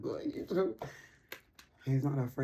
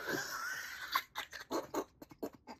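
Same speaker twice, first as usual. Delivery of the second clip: high squealing laugh; 6 dB quieter than usual. Real, not acted. Cackle after the punchline.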